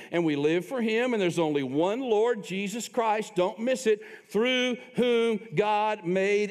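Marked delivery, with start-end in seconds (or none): none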